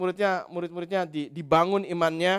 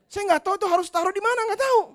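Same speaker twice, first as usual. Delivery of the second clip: exasperated; 13 semitones higher, faster than usual. Retold or imitated. Exasperated husband with leftover parts.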